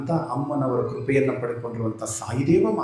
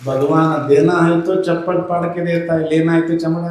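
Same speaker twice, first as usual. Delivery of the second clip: raised, loud sermon voice; preaching; 5 semitones higher, 6 dB louder than usual. Acted. Preacher telling listeners the poison was in their hearts, loud and high. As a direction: hammer the moral home to the crowd. Preacher drives home the moral.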